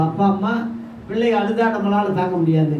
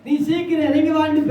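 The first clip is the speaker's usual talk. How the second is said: high-pitched summons, as Jesus to Zacchaeus; calling by name; 7 semitones higher than usual. Acted. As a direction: call him down in a raised pitch. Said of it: "பேரு கூப்பிட்டது போல".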